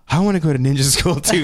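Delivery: a hint of an eager child; eager little nephew. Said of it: "he turned up and was like".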